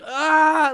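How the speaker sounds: high loud imitated battle yell; mock war cry. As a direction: howl it like a warrior charging. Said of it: "like a war cry".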